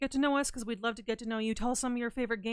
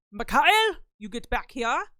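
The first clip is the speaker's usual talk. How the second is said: loud mock German doctor accent calling out; playful German doctor accent shout; 7 dB louder than usual. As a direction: bark the name in a hammed-up German doctor accent. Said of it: "like a German doctor".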